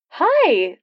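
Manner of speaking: a bright hello in a hinted woman's voice; a hint of a woman answering the radio call; female voice. A narrator's reading. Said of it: "said a female voice".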